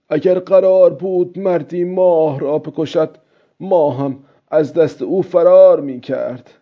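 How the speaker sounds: put-on high-pitched musing old man; old fisherman. Old man imagining hunting the moon, character voice.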